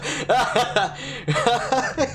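high, helpless laugh; real burst of laughter; laughing at oneself. Real, not acted. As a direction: crack up in a high-pitched laugh. Laughing at his own mistake.

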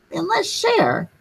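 put-on high little girl voice; a hint of a small girl offering; lightly voiced little girl. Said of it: "A little girl said".

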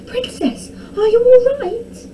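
high, urgent concerned call; alarmed cry. A narrator's reading. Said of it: "cried one of the men".

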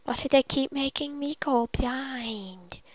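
soft whisper of frustration; whispered complaint. Pretend. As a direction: mutter it under your breath.

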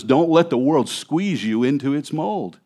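normal talking voice

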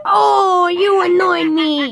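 wailing complaint, voice up; whiny crying shout. Acted. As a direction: cry it out like a hurt kid. Logo cries out when annoyed.

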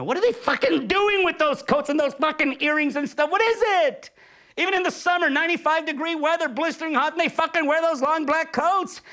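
high, loud exasperated outburst; shrill indignant tirade; exasperated rant. Real, not acted. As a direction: rant at the absurd coats. Ranting mock outrage about directors' coats.

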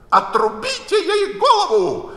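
very high, loud, raging order; the Queen shrieking for an execution; an enraged royal yell; furious scream. A narrator's reading. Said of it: "закричала королева в ярости".

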